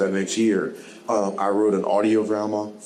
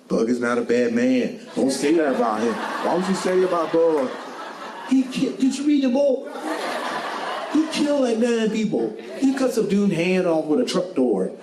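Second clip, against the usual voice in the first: mock-outraged fan, much higher and laughing; indignant fans; 8 semitones higher than usual. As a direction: squeal their defence of Bug. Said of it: "so many people come in the comments".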